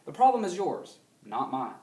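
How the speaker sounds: "The problem is yours," then a clear pause before "not mine."; pause at the comma. Shown as a demonstration. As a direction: lean on "yours," and let a beat sit before "not mine.". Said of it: "You can hear that comma in my voice".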